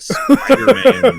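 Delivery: high, loud, slow announcer-style call; Spider-Man call. Pretend. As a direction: stretch it out like a mock announcement. Teasing call during spider scare.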